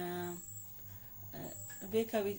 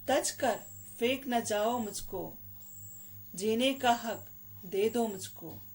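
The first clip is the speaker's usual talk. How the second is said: louder, deliberate recital, each line swelling and then falling; poem recitation; 5 dB louder than usual. Acted. Daughter pleading with parents to let her live, declaimed poem. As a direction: declaim it in measured phrases with emphatic pauses.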